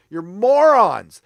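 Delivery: loud, slow, drawn-out insult; angry insult. Real, not acted. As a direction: spit each word out, furious. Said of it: "Sorry, I get mad".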